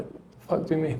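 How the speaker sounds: hushed, baffled aside; muttered disbelief. Retold or imitated. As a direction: mutter it under your breath, puzzled. Player's baffled muttered reaction to coach.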